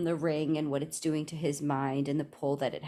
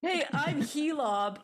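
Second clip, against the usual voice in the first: higher, slow, silly spider husband; goofy Helob voice; 9 semitones higher, slower than usual. Pretend. Helob to Frodo in the web, dopey high greeting. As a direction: introduce yourself like a dopey spider bro.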